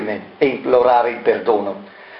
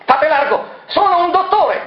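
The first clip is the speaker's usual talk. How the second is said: much higher, shouted command. Acted. Moroni shouts to the crowd to let the doctor through. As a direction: make way, call it out. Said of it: "gridò Moroni".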